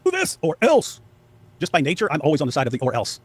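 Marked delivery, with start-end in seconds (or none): 0.1-1.0 high put-on voice for a bully's ultimatum; mocking threat. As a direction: sneer it out as the bully. Quoting Sam's threat.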